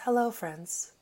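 soft, hushed golf-broadcast welcome; lightly imitating a quiet TV announcer; hushed Jim Nantz. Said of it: "but he always goes".

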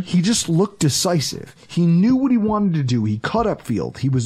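ordinary talk

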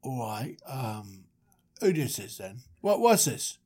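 lightly voiced [name], mostly low; suspicious [name]. [name] asking who is pranking him, low and suspicious. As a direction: play a wary bloke smelling a prank.

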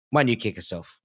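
usual speaking voice

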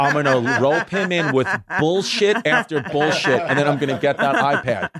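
loud, laughing imitation of a schemer; hammed-up plotting with rising force; mock scheming. Wife exposing daughter's iPad plot, loud mock scheming.

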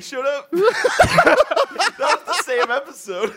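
loud laughing recall; cracking up while talking. Real, not acted. Laughing at memory.

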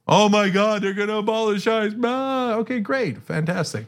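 high, hammed-up alarm fading to sarcasm; host mocking Fox News panic, high and sarcastic; mock panicked pundit. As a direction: parody a frantic cable host. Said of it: "Fox News goes the whole time like".

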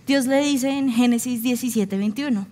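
normal talking voice

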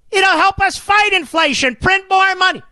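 mock Democrat. Mocking Democrats' claim.

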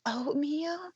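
put-on small, high, sad little kid voice; a playful tiny child naming things. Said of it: "point to things".

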